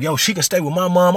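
high, insistent retold argument; getting mad. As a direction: plead the case with rising irritation. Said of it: "I'm getting mad".

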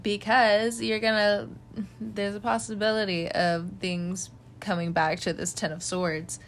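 ordinary delivery

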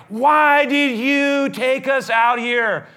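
a crowd screaming at Moses about starving; furious complaint. Retold or imitated. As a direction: shout the blame and demand food. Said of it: "It's like".

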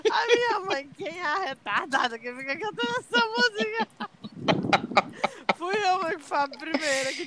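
high voice, laughing while crying; crying with laughter, pitch way up; laughing to tears. Real, not acted.

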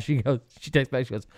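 ordinary talk, nothing marked

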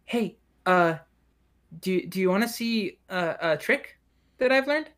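put-on high, hesitant child voice; high-pitched kid, rising into a hopeful question; little elven girl. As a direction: offer to show off as a small girl. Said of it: "and goes".

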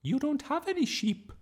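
very high, loud teacher voice; high-pitched, disapproving schoolmistress; frowning teacher. A narrator's reading. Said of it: "Miss Darling frowned".

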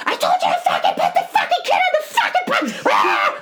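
shrieking mock rage, very high, with a laugh; screaming. Wife reenacting screaming at husband over the accident, shrieking laugh. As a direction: scream it like the Hulk came out. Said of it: "I'm screaming at him".

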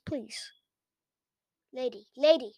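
normal talking voice

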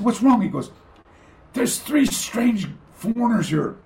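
exasperated and puzzled; surprised sensei. Retold or imitated. The sensei complains about strange foreigners, exasperated. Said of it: "And he goes".

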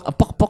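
high, short "pok" sounds like taps; imitated clapping knock. Shown as a demonstration. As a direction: pop out each pok like a clap. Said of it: "Kayak ditepuk".

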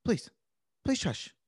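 stern, then begging. Shown as a demonstration. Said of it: "a little bit more stern".

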